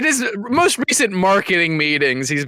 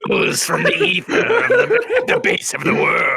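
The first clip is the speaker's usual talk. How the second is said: epic storyteller; 4 semitones higher than usual. Acted. Epic tale of the Cum Goblin.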